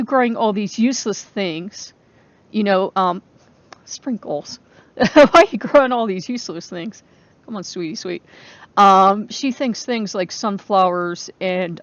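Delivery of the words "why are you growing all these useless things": the sister's jab again, pushed louder and rising; louder mocking repeat. Quoting her critic a second time, louder and scornful. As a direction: throw her question back, louder and incredulous. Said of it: "she's saying".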